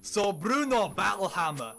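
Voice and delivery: gruff Scottish dwarf quote, hammed up; bad Scottish accent. Reading the card's quote in a terrible Scottish accent. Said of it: "done in a very bad Scottish accent".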